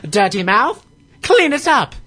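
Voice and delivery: high, loud prim scold; mock scolding. Scolding a friend's dirty mouth in a high mock voice.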